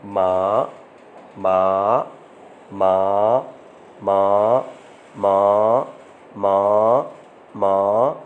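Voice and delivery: माँ held long and level, low and steady; long level first tone. Shown as a demonstration. Teacher to students, demonstrating the level tone: माँ long and flat. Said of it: "ऐसे लंबाई में".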